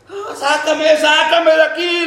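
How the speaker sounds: high, drawn-out cry for help; wailing plea, acted out; reenacted desperate scream. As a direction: imitate a trapped man begging for rescue. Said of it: "le gritaba".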